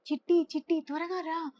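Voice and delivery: high-pitched alarmed call; frightened urgency. A narrator's reading. Rabbit runs up to Chitti in fear, urgent call. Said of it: "భయంతో పరిగెత్తుకుంటూ వచ్చింది".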